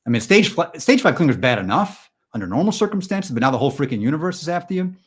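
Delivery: agitated stand-up rant; exasperated rant. Acted. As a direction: blow up in mock outrage. Mock rant about clingy universe.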